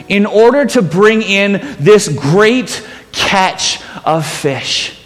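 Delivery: ordinary talk, nothing marked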